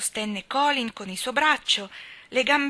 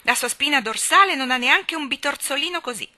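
loud and high throughout; raised, outraged voice defending the boy; indignant; 6 semitones higher, 7 dB louder than usual. A narrator's reading. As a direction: insist hotly that she checked herself. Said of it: "gridò Mary indignatissima".

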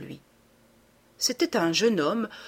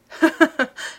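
éclat de rire aigu et fort; rire moqueur; 9 semitones higher, 7 dB louder than usual. A narrator's reading. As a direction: rire franc de Lupin. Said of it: "Il rit de nouveau". (Lupin se moque du narrateur, éclat de rire aigu)

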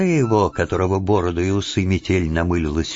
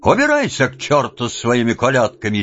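angry shout; 6 semitones higher, 3 dB louder than usual. Acted. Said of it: "сердито закричал Вакула".

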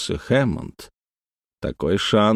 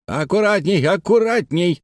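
anxious cry; 9 semitones higher than usual. A narrator's reading. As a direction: cry out a warning to the crane crew. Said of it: "закричал Хэммонд". (встревоженный окрик высоким голосом)

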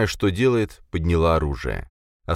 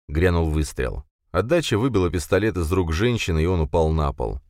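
ordinary delivery

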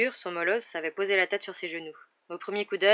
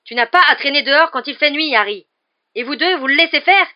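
loud, higher, furious scolding; angry shouting; 8 semitones higher, 15 dB louder than usual. Acted. Hagrid scolds the children for coming, furious shout. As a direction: tear into them like a worried giant. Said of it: "avec colère".

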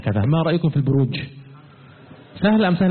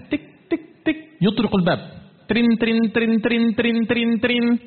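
knock and ring sounds; 7 semitones higher than usual. Pretend. Imitates knocking and doorbell.

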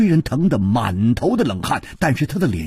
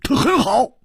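high, terse, cold reply; cold insistence. A narrator's reading. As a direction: state it coolly, pitched well up. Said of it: "一点红还是冷冷地说".

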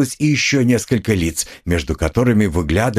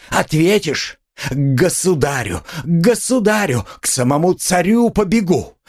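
high-pitched, frantic outrage rising in waves; frenzied tirade; 9 semitones higher than usual. A narrator's reading. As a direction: rant and challenge them at fever pitch. Катерина в исступлении бранит и требует обыска. (исступлённая тирада высоким голосом)

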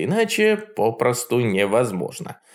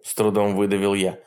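ordinary delivery

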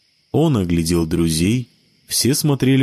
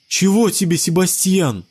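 irritated shout; 10 semitones higher than usual. Acted. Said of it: "раздражённо выкрикнула Джессика".

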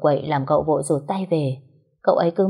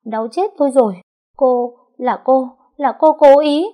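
higher, louder crying complaint; wailing accusation; 8 semitones higher, 8 dB louder than usual. A narrator's reading. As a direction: wail in pain and blame her. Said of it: "ôm chân khóc lóc". (khóc lóc kêu đau, buộc tội)

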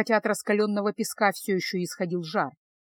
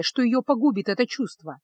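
ordinary delivery

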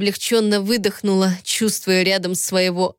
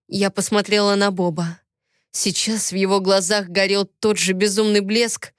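usual speaking voice

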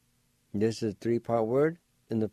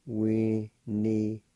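Winnie-do-yukta said slowly and carefully; slow Winnie-do-yukta down for the learner; slow demonstration; slower than usual. Shown as a demonstration. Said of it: "like this".

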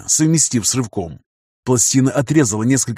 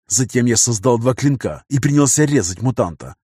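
usual speaking voice

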